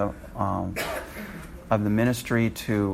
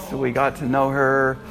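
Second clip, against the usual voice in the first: ordinary talk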